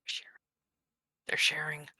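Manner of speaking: very soft, low whisper; whispered echo. Acted. Onlooker echoes the merged creature's news, whispering. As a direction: repeat it under your breath.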